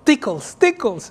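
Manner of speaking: put-on high child begging tickles, rising; lightly voiced little girl. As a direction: plead like a playful toddler. Hija pide cosquillas. (voz ligera de niña pidiendo cosquillas)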